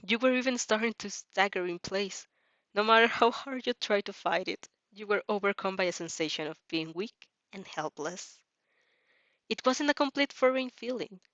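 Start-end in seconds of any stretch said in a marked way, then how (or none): none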